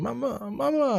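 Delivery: high mock toddler wail for mama; toddler screaming. Dad imitating his son waking up screaming for mama. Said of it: "he pops up just screaming".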